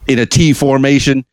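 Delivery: ordinary talk, nothing marked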